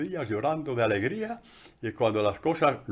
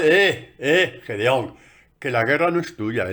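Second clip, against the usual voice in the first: divine rebuke; 3 semitones higher, 6 dB louder, slower than usual. Retold or imitated. God rebukes Gideon.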